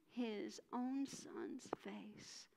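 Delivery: slow whisper on "own son's face"; whispered sorrow. A narrator's reading. Narrator telling how the father shut the door, slow whisper. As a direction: let it fall to a whisper.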